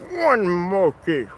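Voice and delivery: slow, high Transylvanian count voice; Count impersonation. Pretend. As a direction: count out slowly like a vampire count. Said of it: "count impersonation".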